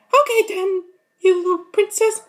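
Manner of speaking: high, loud mocking cartoon voice; squeaky taunting voice. Acted quarrel, squeaky voice.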